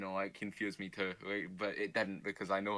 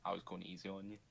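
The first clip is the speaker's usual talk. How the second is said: put-on Scottish accent; 7 dB quieter than usual. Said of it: "am I still doing the Scottish accent".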